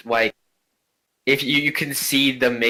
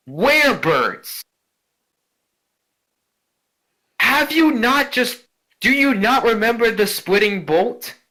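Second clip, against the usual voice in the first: indignant grandmother; 10 semitones higher than usual. Acted. Ghost grandmother outraged at the slayer, high indignant cry. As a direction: shriek it in disbelief.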